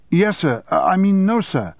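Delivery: high, flustered stammer. Acted. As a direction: caught off guard, stumbling over answers. Flustered soldier answering sergeant.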